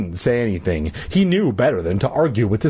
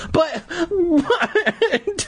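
higher, laughing through a startled but what; sputtering laugh. Acted. Son laughing at father's order, sputtering in disbelief. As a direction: break into a laugh mid-protest. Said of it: "Todd sputtered".

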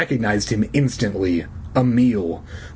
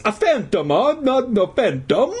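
put-on high, questioning Italian accent; surprised old Italian janitor; 10 semitones higher than usual. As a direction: puzzle over it in cartoon Italian. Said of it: "in his hoarse Italian voice".

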